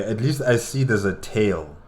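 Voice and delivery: ordinary delivery, nothing marked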